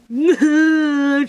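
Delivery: mocking laugh. Teasing herself about eating moose.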